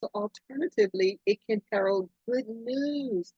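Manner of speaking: usual speaking voice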